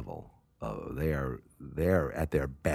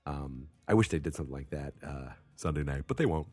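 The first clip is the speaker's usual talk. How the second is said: ordinary talk, nothing marked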